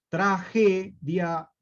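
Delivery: "Tragedia" slowly broken as "traje... dia"; word split in two. Shown as a demonstration. Teacher shows the word traje hidden inside, "Tragedia" slowly split. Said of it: "se parece mucho a traje".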